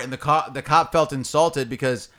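ordinary talk, nothing marked

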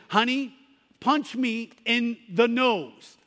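mildly frustrated mother, slow and pressing; frustrated mom. Retold or imitated. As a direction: push the boy, a touch of impatience. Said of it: "she was a little bit frustrated".

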